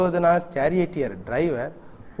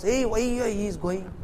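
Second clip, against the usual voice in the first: mild retold shout; 6 semitones higher, slower than usual. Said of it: "and he shouts".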